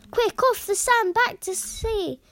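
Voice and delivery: high, loud urgent cry; a tiny snail crying out in alarm. A narrator's reading. Snail urging the stranded whale back to sea, high urgent cry. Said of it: "cried the snail".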